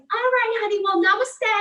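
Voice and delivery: high, loud imitation of her mom's New England accent. Mom on the phone wishing her daughter well, in a mock New England accent. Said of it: "very, very, um, New England accent".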